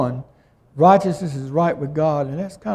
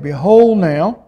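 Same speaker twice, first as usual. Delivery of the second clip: slow, louder, emphatic Behold now; bolder plea; 2 semitones higher, 6 dB louder, slower than usual. Retold or imitated. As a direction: step up boldly before God. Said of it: "he had to get a little bit more bolder".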